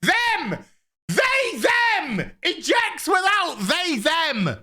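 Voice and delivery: exasperated shout. Real, not acted. Reviewer mocking pronoun correction, high exasperated shout.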